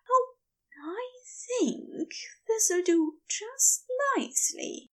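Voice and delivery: high, hushed, approving murmur; whispered. A narrator's reading. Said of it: "he whispered".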